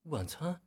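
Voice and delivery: 晚餐 lifted high into a question; high-pitched, doubtful 晚餐. Shown as a demonstration. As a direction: echo 晚餐 as if puzzled. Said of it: "有点带疑问的感觉".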